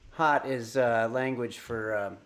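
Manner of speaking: ordinary talk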